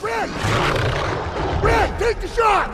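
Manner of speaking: very high, very loud, slow yell; desperate shout. Acted. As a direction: scream the order. Desperate order to sniper.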